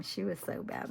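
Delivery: usual speaking voice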